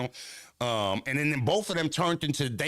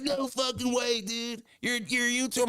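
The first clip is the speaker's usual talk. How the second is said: much higher, stoked skater-bro voice; skater dude amazed; 11 semitones higher than usual. Retold or imitated. The fan learns he's a YouTuber, high amazed skater voice. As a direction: gasp it out like a thrilled skate kid. Imitating the fan's excited reaction.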